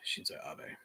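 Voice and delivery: soft whispered name; hushed whisper. Acted. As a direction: breathe the name under your breath. Reading name aside.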